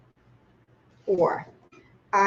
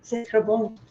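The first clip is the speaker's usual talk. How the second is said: put-on light French accent on C'est très bon; saying C'est très bon the French way; French phrase. For a Paris guest, a light French accent. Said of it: "she tried to say".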